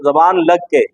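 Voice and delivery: ordinary delivery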